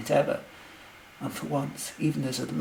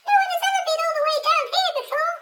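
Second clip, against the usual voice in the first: very high, rapid squeak; excited tiny squeaky voice; eeping nurse; 10 dB louder, faster than usual. A narrator's reading. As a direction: squeal it fast and loud. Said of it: "eeped the nurse".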